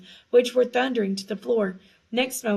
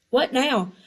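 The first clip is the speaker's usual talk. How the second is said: higher, louder, desperate; 4 semitones higher, 5 dB louder than usual. A narrator's reading. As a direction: ask it in desperation. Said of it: "sounding desperate".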